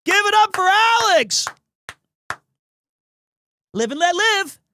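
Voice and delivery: high, loud crowd-pumping call; rally the audience for him; hype call. Acted. Streamer calling on chat to cheer Alex, loud hype call.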